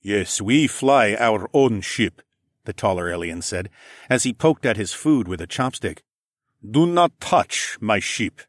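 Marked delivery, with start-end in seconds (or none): 0.0-2.2 slow, heavy alien man. A narrator's reading. Tall alien voice. 6.7-8.4 slow, heavy alien man. A narrator's reading. Tall alien voice.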